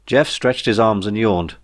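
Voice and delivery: usual speaking voice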